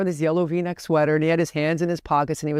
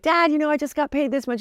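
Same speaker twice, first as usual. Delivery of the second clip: much higher, bright bid for praise; a grown daughter telling dad, pitched up high; eager daughter to dad; 11 semitones higher than usual. Retold or imitated. Daughter bragging to dad, much higher.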